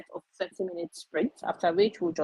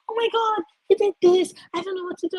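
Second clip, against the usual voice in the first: much higher, panicked rush; mock panic; 13 semitones higher, 3 dB louder, faster than usual. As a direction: play the frantic new pet owner. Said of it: "I'd be like".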